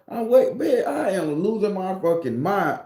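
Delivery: high-pitched comic panic; mock freak-out. Man seeing a fairy on his shelf, freaking out.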